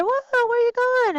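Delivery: surprised whoa. Pretend. Teacher playfully startled at her own screen, high surprise. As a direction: act startled as the figure slips away. Mock surprise at moving figure.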